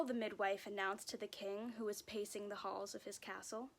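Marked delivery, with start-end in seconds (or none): none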